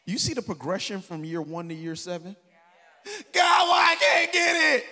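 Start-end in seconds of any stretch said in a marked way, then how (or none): 3.3-4.8 loud, higher, slow mock complaint to God; whining prayer. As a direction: wail like a frustrated believer. Whiny year-one prayer imitated.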